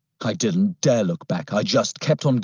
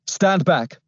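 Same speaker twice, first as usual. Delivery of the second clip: firm, raised policeman's order; commanding policeman; 9 semitones higher, 3 dB louder than usual. A narrator's reading. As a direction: order the crowd back. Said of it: "he ordered".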